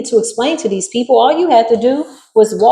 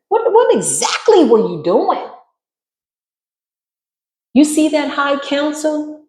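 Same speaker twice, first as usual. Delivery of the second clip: incredulous high challenge; 7 semitones higher, slower than usual. Acted. Confronting the intruders.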